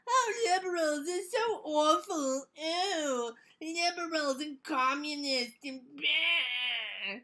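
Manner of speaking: high, slow sneering villain drawl; mustache twirling villain. Retold or imitated. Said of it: "he sounds like an evil, mustache-twirling villain".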